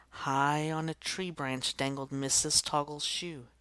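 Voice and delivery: usual speaking voice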